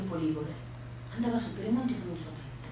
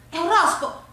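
excited; 10 semitones higher, 10 dB louder than usual. Acted. Boy shows girl a toad.

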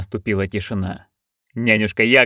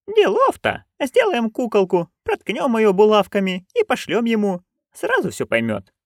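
put-on higher, eager old crone; Nanny Ogg voice; 11 semitones higher than usual. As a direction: pitch up into a gleeful old busybody. (высокий азартный голос старой ведьмы)

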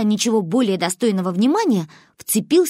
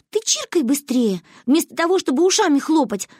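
put-on cross little girl, pitched high; annoyed high-pitched girl; Манюня; 8 semitones higher than usual. A narrator's reading. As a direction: scold impatiently in a high child's voice. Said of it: "рассердилась Манюня".

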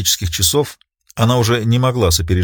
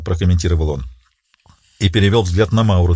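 plain reading voice

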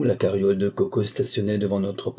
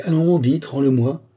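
higher, louder impatient demand; 5 semitones higher, 5 dB louder than usual. A narrator's reading. Girl snapping impatiently at the boy for her flower, louder and higher. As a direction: snap at the boy to hand the flower back. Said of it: "répliqua-t-elle impatientée".

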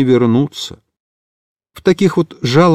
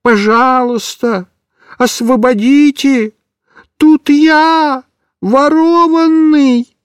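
shouting with all his might; 9 semitones higher, much slower than usual. A narrator's reading. Kitten shouting from the sack for rescue. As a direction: yell so the gendarmes hear. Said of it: "Микиш закричал изо всех сил".

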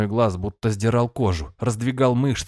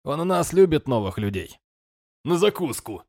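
rough jeering; 5 semitones higher than usual. Acted. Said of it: "грубо рубанули рядом".

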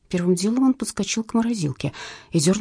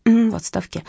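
disappointed; 2 semitones higher than usual. A narrator's reading. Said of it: "разочарованно протянула женщина".

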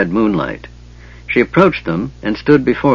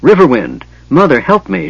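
higher, louder desperate plea; frightened cry to her mother; cry of agony; 5 semitones higher, 3 dB louder than usual. A narrator's reading. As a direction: call out for help in anguish. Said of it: "she cried in agony".

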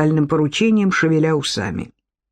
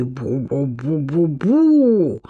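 low, drawn-out creature babble; slow, low costumed-dragon mumble; dragon's bu-bu; 4 semitones lower, much slower than usual. A narrator's reading. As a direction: boom out slow nonsense syllables in a low voice. Narrator voices the muffled dragon.

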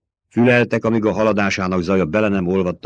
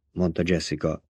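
ordinary delivery, nothing marked

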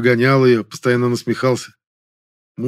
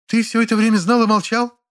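high, far voice of an upset mother; a mother crying out in dismay; shocked mother; 12 semitones higher than usual. A narrator's reading. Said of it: "всплеснула руками Надежда Петровна".

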